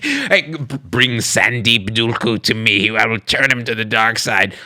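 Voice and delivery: lightly voiced villain; menacing dark lord, mildly hammed; Sith Lord. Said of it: "sounds like you're a failed Sith Lord".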